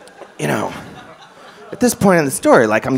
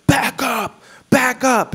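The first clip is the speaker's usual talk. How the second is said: high, sharp barked warning; gruff homeless yell; 11 semitones higher, slower than usual. Retold or imitated. Homeless man orders comedian away, high barking yell. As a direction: bark it like a cornered vagrant.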